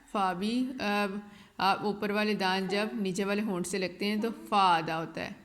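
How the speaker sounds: ordinary talk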